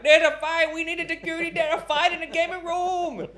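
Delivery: very high, loud hammed-up panic; frantic radio caller. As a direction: imitate an attendant shrieking for security over the radio. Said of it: "I heard on the radio the Thai guy like".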